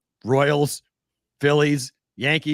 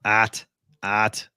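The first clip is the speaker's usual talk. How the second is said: Boston "at"; 7 semitones lower, much slower than usual. Shown as a demonstration. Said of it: "we change everything into an A".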